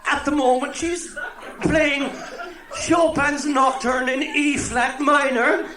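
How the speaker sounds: put-on high, slow calling old woman; old Cork woman. Old woman calling to her friend over the partition, high and slow. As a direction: call over the toilet partition. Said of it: "shouts back".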